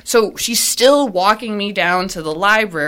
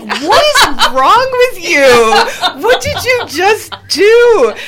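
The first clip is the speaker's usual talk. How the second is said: high, loud, slow disbelieving cry through laughter; laughing outburst of disbelief, drawn out; incredulous shout; 12 semitones higher, 7 dB louder, much slower than usual. Retold or imitated. Teacher shouting at the principal, high, loud and laughing in disbelief.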